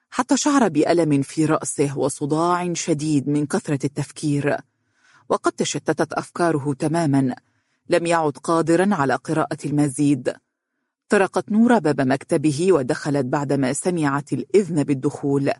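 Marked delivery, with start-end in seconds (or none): none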